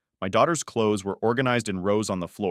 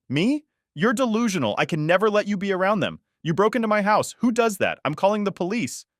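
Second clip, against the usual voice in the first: outraged higher shouting at the intruder; furious confrontation; 11 semitones higher than usual. Retold or imitated. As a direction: tell her off at full pitch. Confronting intruding mother-in-law.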